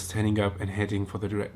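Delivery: usual speaking voice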